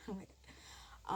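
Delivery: barely voiced, crying; almost no voice left, grief; choked up. Real, not acted. Telling viewers of grandmother's death, choking up. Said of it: "I broke down".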